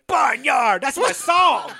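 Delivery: high, whooping shout; hammed-up excited cry; mock excited shout. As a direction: holler it like a fan at a concert. Acts out character shouting for song.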